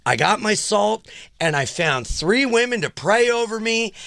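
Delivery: mock triumphant announcement; smug excited boast. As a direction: brag to the hotel lady.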